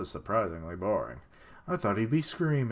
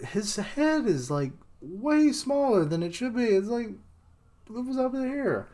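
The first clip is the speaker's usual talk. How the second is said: much higher, squeaky amused voice; squeaky mock voice; 12 semitones higher than usual. Real, not acted. Reader mocking a tiny-headed character in a squeaky voice.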